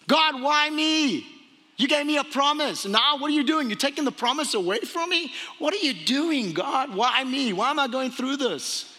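high, insistent pleading with God; acted bewildered outcry, sustained high; anguished protest. Acts out Abram protesting to God.

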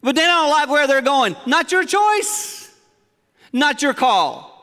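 Not your choice drawn out, slow and loud; forceful preaching. Real, not acted. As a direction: hammer it home from the pulpit. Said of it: "You're saying this very forceful".

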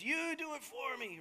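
put-on whiny toddler handing off the chore; daughter's voice. Dad imitating his three-year-old's whiny voice. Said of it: "I don't know why she has that voice".

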